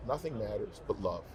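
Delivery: usual speaking voice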